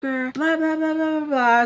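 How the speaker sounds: mocking rant. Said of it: "going off".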